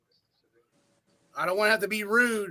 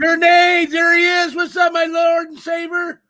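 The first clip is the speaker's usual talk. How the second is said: high, loud, drawn-out calling; 7 semitones higher, 6 dB louder, slower than usual. Real, not acted. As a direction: bellow the name like a chant. Greeting a guest, calling out.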